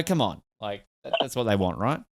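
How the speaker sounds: ordinary talk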